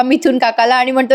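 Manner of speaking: ordinary delivery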